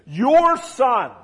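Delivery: furious elder son rejecting his brother; angry retort. Acted. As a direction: fling the words at his father. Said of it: "You get the intensity of his anger".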